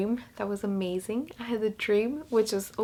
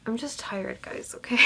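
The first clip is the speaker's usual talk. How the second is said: breathy whisper, voice dropped away; whispering wearily to viewers; tired whisper; 6 dB quieter than usual. Real, not acted. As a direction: confide it to the camera in a whisper.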